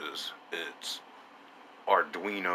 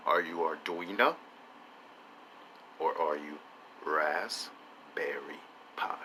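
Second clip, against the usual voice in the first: soft ask, Raspberry Pi drawn out slowly in a whisper; drawn-out whispered repeat; slower hushed repeat; 3 semitones lower, 8 dB quieter than usual. Acted. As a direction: repeat it slower and more secretive. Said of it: "I ask you again".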